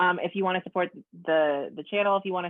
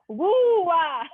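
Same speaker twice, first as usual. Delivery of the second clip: laughing; 13 semitones higher, 7 dB louder than usual. Real, not acted. Mocking name sound, laughing.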